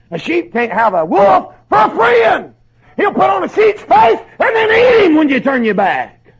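loud, high, hammering sermon delivery; fiery preaching. Acted. Preacher warns parents and young people about wolves, at full force. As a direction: preach it hard to the congregation.

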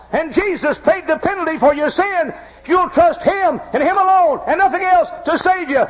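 high, loud preacher's build; full-force preaching. Acted. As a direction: drive it home to the congregation. Preaching climax.

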